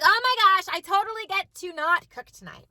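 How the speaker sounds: excited relief. Retold or imitated. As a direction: celebrate a small victory. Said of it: "literally the biggest victory of the night".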